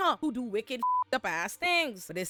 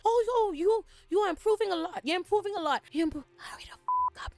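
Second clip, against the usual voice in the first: high, sing-song coaxing voice, much higher pitch; high mock-encouraging trainer voice turning impatient; fake-sweet Larys; 11 semitones higher than usual. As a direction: coo praise then snap into a shrill hurry-up. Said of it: "Larys is like".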